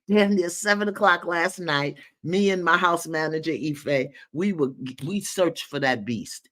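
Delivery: ordinary talk, nothing marked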